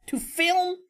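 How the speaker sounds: high incredulous question; incredulous echo. Real, not acted. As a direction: echo it back in disbelief.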